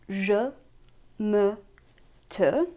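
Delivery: Je, me, te with a neutral "uh" vowel; unaccented French schwa in Je, me, te; normal French e. Shown as a demonstration. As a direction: say Je, me, te one by one, rhyming with "uh". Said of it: "examples for our normal E".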